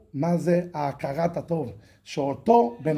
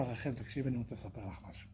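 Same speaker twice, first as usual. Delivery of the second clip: much softer, lower, quick confession; hushed confiding; 9 semitones lower, 19 dB quieter, faster than usual. Acted. The boss confiding in Rachel, soft and low. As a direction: lean in and tell a secret softly.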